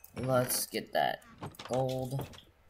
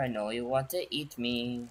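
normal talking voice

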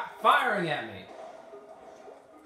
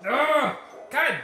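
angry outburst; 6 semitones higher, slower than usual. Real, not acted. Gamer cursing at a hard level, angry shout. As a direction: spit it out furious.

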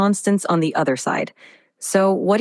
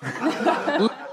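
light laugh; 6 semitones higher, 5 dB quieter than usual. Real, not acted. Assistant laughs at the mix-up.

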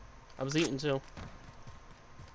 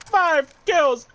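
excited kill count; 6 semitones higher, 4 dB louder than usual. Real, not acted. Counting kills in a gunfight.

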